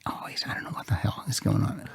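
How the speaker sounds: whispered baffled aside. Real, not acted. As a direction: drop to a whisper, bewildered. Confused aside during story.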